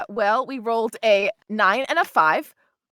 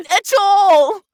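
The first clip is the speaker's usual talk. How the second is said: loud, put-on Scottish lilt, drawn out in places; a bashful character hamming up a Scottish accent through laughter; bad dwarven Scottish voice; attempted Scottish accent; 6 semitones higher, 11 dB louder, much slower than usual. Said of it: "Like the Scottish, slightly".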